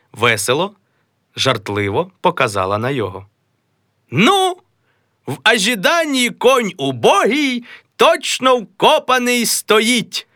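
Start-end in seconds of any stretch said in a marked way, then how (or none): 4.1-10.3 high, slow mocking recital; a high jeering old uncle. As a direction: tease him with a sing-song quote. Uncle Taras mocks, quoting verse.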